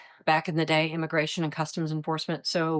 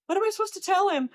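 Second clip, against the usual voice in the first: high, fast, frantic plea; a new agent out of his depth, begging for help; mock panic, pitched way up; panicked plea; much faster than usual. Rookie agent panicking on duty call.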